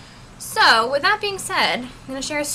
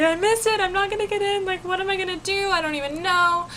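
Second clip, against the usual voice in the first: high-pitched panicky whine; mock despairing wail; imitating bawling; 8 semitones higher than usual. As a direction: act out a tearful meltdown, much higher. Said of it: "I'm bawling my eyes out".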